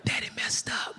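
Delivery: put-on hushed guilty whisper to a sick child; whispered apology to his son. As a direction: breathe it softly at the bedside.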